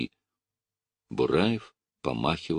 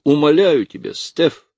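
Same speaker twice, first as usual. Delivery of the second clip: high, loud, desperate plea; crying out; 10 semitones higher, 8 dB louder than usual. A narrator's reading. Lucy begs him not to open it, crying out. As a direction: beg at full pitch. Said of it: "закричала Люси".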